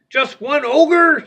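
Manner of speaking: high, slow scoff at the threat. Acted. Tough scoffs at his brothers' worry, high mocking scoff. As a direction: sneer at one puny ogre. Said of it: "scoffed Tough".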